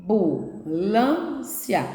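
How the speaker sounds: AMBULÂNCIA very slow, each syllable set apart. Shown as a demonstration. Teacher recounts the four syllables for pupils, slow separated demonstration. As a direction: pause after each piece of Ambulância: am, bu, lân, cia. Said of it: "Você vai falando e cada sílaba".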